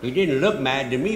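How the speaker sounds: ordinary talk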